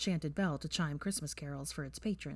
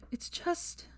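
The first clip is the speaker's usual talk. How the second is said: high unvoiced sigh of words; a sighed half-whisper; 12 semitones higher, 4 dB quieter than usual. A narrator's reading. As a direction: breathe it out wearily. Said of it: "Granger sighed".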